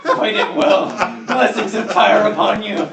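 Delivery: loud, high, slow ceremonial blessing; solemn blessing. Acted. Cleric blessing the fighter before the duel, loud solemn blessing. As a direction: intone it like a priest.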